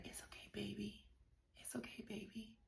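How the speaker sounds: very soft, high whisper; whispered soothing. Retold or imitated. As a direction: imitate a partner's hushed comforting. Acts out husband comforting her before doctor.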